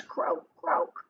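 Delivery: storybook frog croaks. A narrator's reading. Frog character croaks in read story.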